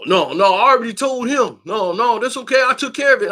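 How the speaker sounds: high nervous brush-off; imitating Jamal backing off.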